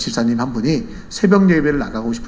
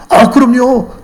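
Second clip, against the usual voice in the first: high, loud eager yes; emphatic assurance; 9 semitones higher, 7 dB louder, much slower than usual. Retold or imitated. Hen owner assures the seller she fed it, emphatic. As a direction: agree instantly, voice lifted.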